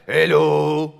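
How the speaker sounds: hammed-up comedian's French accent; mock French accent. Comedian imitating a French waiter greeting diners, exaggerated French accent. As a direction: lay the cliché French accent on thick. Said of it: "doing the French accent".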